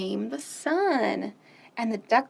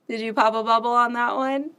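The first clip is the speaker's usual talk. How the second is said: usual speaking voice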